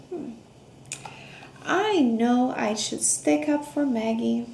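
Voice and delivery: plain reading voice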